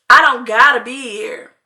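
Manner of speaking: slow, loud "I don't gotta be here"; emphatic retort. Retold or imitated. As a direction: lean hard and slowly on every word of "I don't gotta be here". Retold argument, point repeated emphatically.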